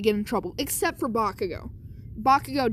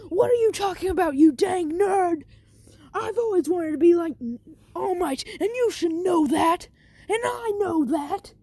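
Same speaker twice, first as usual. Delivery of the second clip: angry bully; 6 semitones higher than usual. Retold or imitated. Bakugo snapping at Deku about All Might, high mock-angry rant. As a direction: rant at him like a bratty rival.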